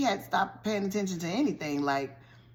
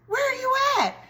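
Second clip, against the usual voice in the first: high, loud frantic shout; pitched-up imitated panicked call; 4 dB louder, faster than usual. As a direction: yell across the park for your child. Mom calling for lost son.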